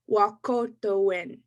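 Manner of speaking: Wahkohtowin said slowly, syllable by syllable; slow Cree word. Shown as a demonstration. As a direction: model Wahkohtowin slowly for the crowd to repeat. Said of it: "I'll say them slow".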